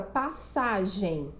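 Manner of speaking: ordinary talk, nothing marked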